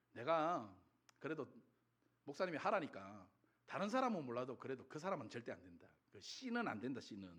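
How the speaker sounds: low, quiet mock grumble; stubborn refusal. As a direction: refuse under your voice, dug in. Imitated stubborn refusal to forgive.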